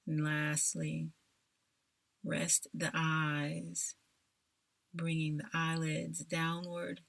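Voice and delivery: ordinary talk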